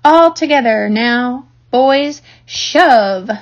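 loud, slow heave-ho; rally the men to push together; effortful team command; rallying call. Acted. Farmer calls the men to push.

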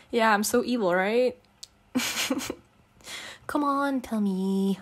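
ordinary delivery

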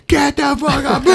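high mock crowd cheer; squealing happy crowd. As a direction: shout like a delighted audience.

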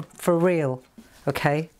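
normal talking voice

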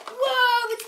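loud delighted exclamation; excited whoa. Real, not acted. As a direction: shout it out in wonder. Opening present.

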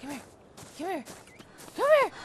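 high, loud calls to come close; urgent coaxing. Real, not acted. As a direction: coax him over urgently. Coaxing the pet onto shoulder.

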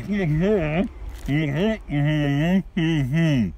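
muffled closed-lip hums; glued-mouth humming. Acted. Milford greeting people with glued mouth, muffled humming. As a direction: hum the words through sealed lips. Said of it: "he got his mouth glued".

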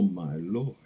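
ordinary delivery

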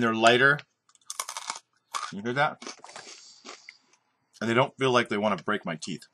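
crunching while talking; talking between bites; mouth full, chewing. Real, not acted. Seen 1: Puts kernel in mouth at -2; eyes closed, jaw working throughout.

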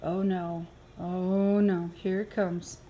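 ordinary delivery

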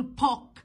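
"بوك" with a puffed p in place of b; wrong heavy p. Shown as a demonstration. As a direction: mispronounce "بوك" as pook, lips popping. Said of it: "ما ينفعش أقول بوك". ("بوك" بباء تقيلة زي p)

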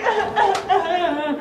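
hammed-up victim's yelps; mock screams. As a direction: cry out in bursts, half laughing. Imitates girl being dragged out.

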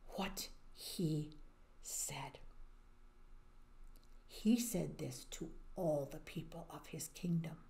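soft whisper building suspense; storyteller whispering before the big decree; hushed storytelling. Real, not acted. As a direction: lean in and whisper it. Storyteller leans in.

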